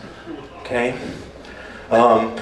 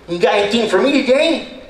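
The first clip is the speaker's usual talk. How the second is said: Eddie's voice; 8 semitones higher than usual. Retold or imitated. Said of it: "he goes".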